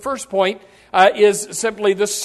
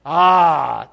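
long, low "ah" of settling down; contented bedtime sigh; 6 semitones lower, 4 dB louder than usual. Pretend. Exhausted sleeper sinking onto the pillow, acted contented sigh. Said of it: "lay your head on your pillow and you say".

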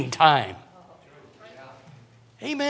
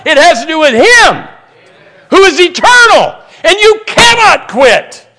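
preaching, building; 2 semitones higher, 8 dB louder than usual. Real, not acted. Preacher urging older church workers not to quit, building in force. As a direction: drive it home, rising to a pulpit shout.

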